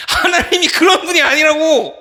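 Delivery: high, loud outcry of protest; emphatic shout. Real, not acted. Preacher correcting the youths' view of God, high emphatic shout. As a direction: cry it out to correct them. Preacher cries out against the belief.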